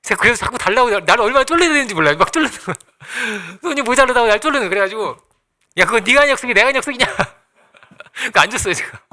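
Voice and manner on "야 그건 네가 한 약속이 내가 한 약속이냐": higher, faster indignant retort; exasperated scolding. Retold or imitated. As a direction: fire back at the daughter in disbelief. 딸을 꾸짖던 말 재연. (빠르고 높게 어이없다는 듯 따지며)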